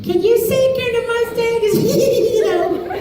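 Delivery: mock eager fan wheedling; begging fan. Fans at every panel begging for the line, high pleading.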